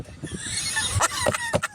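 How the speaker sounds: very high cackle; cracking up. Real, not acted. Storyteller amused at refusing the soft-handed customer, high cackle. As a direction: burst into high-pitched laughter. Laughing at her own line.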